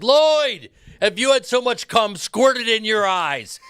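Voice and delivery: loud, high-pitched shouted tirade; Ari Gold. Acted. Actor launches a word-for-word scene at someone's face, shouting. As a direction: yell it like a ranting boss, name drawn out first. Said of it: "everything I said is Ari Gold".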